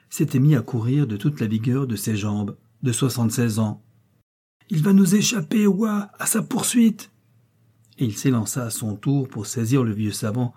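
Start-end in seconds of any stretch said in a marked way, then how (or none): 4.7-7.1 high-pitched urgent cry; urgent calling out. A narrator's reading. Hollander sending Wa after the fleeing professor, urgent cry. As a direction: call her to the chase, voice way up. Calls companion to chase fleeing man.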